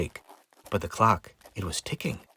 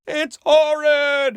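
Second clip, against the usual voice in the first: high, drawn-out wail; horrified scream; 13 semitones higher, 6 dB louder, slower than usual. Acted. Pumpkins fleeing a cute horror, screaming in terror. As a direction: shriek slowly in exaggerated horror. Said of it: "came an almighty scream".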